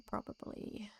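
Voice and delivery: whispered aside. Real, not acted. A streamer whispers an answer to her own question. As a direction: breathe it to yourself.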